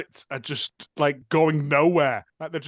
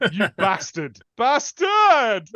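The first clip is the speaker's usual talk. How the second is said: mock outraged curse; 4 semitones higher, 6 dB louder, much slower than usual. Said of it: "he goes".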